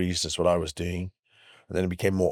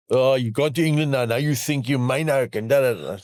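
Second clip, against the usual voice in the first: louder, higher mock jibe; mocking fans; 5 semitones higher, 4 dB louder than usual. Comedian mimics fans he lost after England, mocking and loud. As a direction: parrot the complainers in a raised, scornful voice. Mimicking disgruntled fans' jibes.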